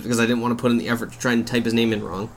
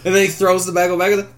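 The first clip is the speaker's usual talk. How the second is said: laughing; 5 semitones higher than usual. Real, not acted. Retelling a funny movie scene.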